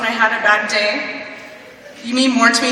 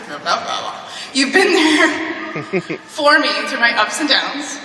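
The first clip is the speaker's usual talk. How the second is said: slow, laughing through the thank-you; laughing through words; slower than usual. Real, not acted. Graduate thanking classmates, slow and laughing through it. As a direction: let the laugh break into the words.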